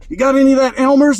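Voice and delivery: high, loud, quick mock begging; imitated playground glue addict. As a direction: hit up a friend urgently, hammed up. Acts out kid hustling for glue.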